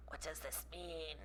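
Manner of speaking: very soft, slow whispered question; puzzled whisper. Real, not acted. Puzzling over a game option.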